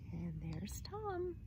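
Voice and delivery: slow unvoiced whisper; storyteller whispering to listeners; whispered aside. Real, not acted. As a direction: lean in and whisper it. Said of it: "narrator aside".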